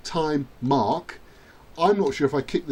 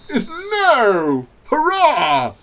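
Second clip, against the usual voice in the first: high, loud drawn-out shout; triumphant cheer; 11 semitones higher, 9 dB louder, much slower than usual. Real, not acted. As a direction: crow it out in triumph. Cheering as game goes his way.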